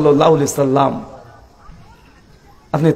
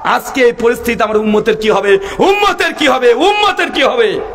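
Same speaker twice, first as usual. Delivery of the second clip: acted anguished lament, pitched way up; imitating tearful crying out; 8 semitones higher than usual. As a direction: cry out high, imploring, as a preacher acting anguish. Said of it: "কাঁদতে কাঁদতে তিনি চিৎকার করে বলছেন".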